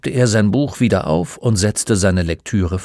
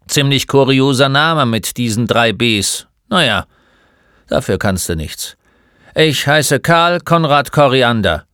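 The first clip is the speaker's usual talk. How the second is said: lightly voiced crusty old man; loud, grudging introduction; grumpy old shopkeeper; 4 semitones higher, 5 dB louder than usual. Old man introduces himself to the boy, mildly grumpy.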